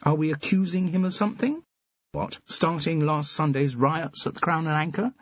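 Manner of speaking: a brisk sceptical friend; lightly voiced sharp friend; Carol's voice. A narrator's reading. Carol voiced, higher pitch.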